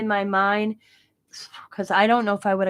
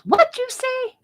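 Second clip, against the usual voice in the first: surprised doctor; 4 dB louder, slower than usual. Retold or imitated. Doctor surprised by her joke.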